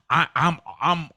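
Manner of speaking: normal talking voice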